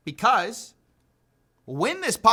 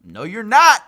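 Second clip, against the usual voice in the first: sarcastic retort; 10 dB louder than usual. Real, not acted. Host retorts at the clip.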